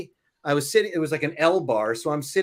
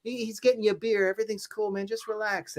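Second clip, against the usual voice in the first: higher, soothing imitation of a calming friend; lightly voiced friend talking you down; mild soothing mock friend; 7 semitones higher than usual. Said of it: "my friend was like".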